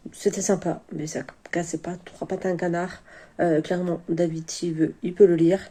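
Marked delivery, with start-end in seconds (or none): 0.1-3.0 hushed, breathy whisper of a verdict. Real, not acted. Whispering a lukewarm book verdict to viewers. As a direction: whisper it so no one else hears. Late book review, voice dropped.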